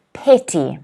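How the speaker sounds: petty, PET stressed and falling. Shown as a demonstration. Teacher models petty, stressing the first syllable. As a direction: hit PET in petty, let ty drop. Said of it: "Stress again on the first syllable".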